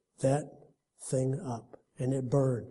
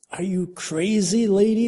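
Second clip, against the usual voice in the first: resisting homeless man; 6 semitones higher, 5 dB louder than usual. A narrator's reading. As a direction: balk at her, incredulous. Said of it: "the homeless man resisted".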